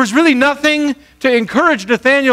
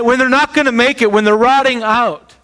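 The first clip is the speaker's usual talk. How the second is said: ordinary talk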